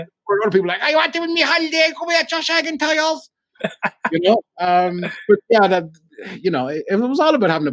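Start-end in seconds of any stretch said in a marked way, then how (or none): 0.8-3.3 mock caller. Caller voiced in radio prank.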